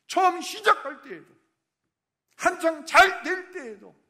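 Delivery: high, loud fervent preaching; preaching at full force. Acted. Pastor urging the congregation toward God alone, fervent preaching. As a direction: drive it home with all your fire.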